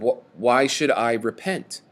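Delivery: ordinary talk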